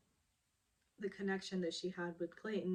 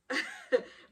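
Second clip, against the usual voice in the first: sudden high-pitched laugh; 5 dB louder than usual. Real, not acted. Vlogger laughing at the drama, high-pitched. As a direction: burst into a laugh.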